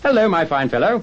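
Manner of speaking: high, loud hearty hail; calling down. Acted. Scrooge to the boy below, loud hearty call.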